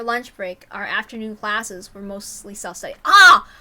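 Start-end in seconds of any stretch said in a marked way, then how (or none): none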